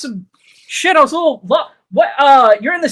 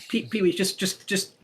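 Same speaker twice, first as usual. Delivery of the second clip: softer, lower urging; hushed; 5 semitones lower, 5 dB quieter, faster than usual. Acted. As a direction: hush the friend along. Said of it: "I'm like".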